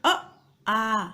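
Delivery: अ said short, आ held longer; brief अ, then stretch आ; short then long vowel. Shown as a demonstration. Teacher contrasting vowel lengths for students, short अ then long आ. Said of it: "इसको बोलने में बहुत कम समय".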